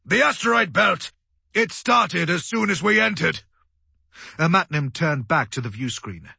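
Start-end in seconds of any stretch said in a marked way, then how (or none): 0.1-3.4 high, loud urgent voice; alarmed report. A narrator's reading. Crewman telling commander where the attack began, alarmed.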